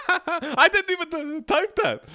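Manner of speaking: high, laughing through the words. Real, not acted. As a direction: laugh while protesting. Laughing at absurd prompt.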